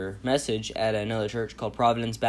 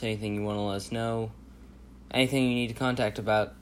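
ordinary delivery

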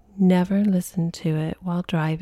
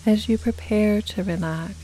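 unhurried hypnotic guidance, letting each phrase settle; guided meditation; 4 dB quieter than usual. Acted. Leads guided relaxation.

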